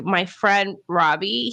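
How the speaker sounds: ordinary talk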